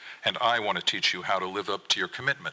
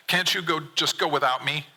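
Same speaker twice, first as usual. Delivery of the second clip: higher, pleading son's voice climbing in distress; rising to a whine as he gets upset; 7 semitones higher than usual. Acted. Son begging dad to go without him, voice rising. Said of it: "Your voice rises as you start getting upset".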